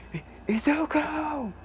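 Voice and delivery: high, drawn-out cry of alarm; Aizawa crying out. Retold or imitated. Teacher sees his student doing monstrous things, crying out. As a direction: call the name out high and stretched. Aizawa cries out on seeing Izuku.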